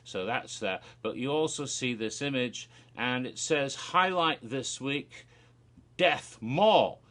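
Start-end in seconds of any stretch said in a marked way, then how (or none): 3.7-7.0 slow, building louder to the title; promo announcer read. Retold or imitated. Reading promo banner.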